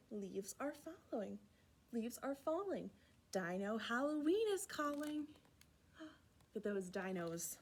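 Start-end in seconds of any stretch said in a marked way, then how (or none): none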